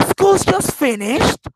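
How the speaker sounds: high, loud, drawn-out surprise ending on a rise; surprised. Shown as a demonstration. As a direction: exclaim it in astonishment, slowly. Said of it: "a surprise. If I say".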